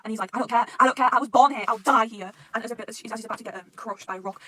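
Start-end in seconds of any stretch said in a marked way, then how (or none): 0.4-2.3 loud, imitated despairing protest; acted defiance. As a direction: throw it out loudly, past caring. Acted defiant quote of despairing character.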